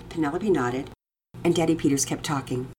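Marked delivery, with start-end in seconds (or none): none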